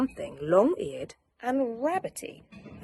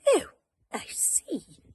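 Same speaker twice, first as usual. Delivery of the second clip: kindly; 10 semitones higher than usual. Acted. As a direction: say it gently, soothing. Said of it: "said the rabbit kindly".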